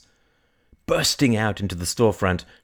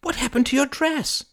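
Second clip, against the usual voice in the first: high-pitched shocked question; alarmed high cry. Acted. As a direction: ask aghast, voice shooting up. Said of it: "cried Kay".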